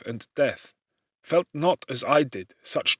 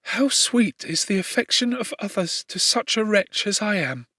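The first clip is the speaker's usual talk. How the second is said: put-on raised, tender young voice full of gratitude; grateful doomed girl; 7 semitones higher than usual. A narrator's reading. Justine voiced in reading.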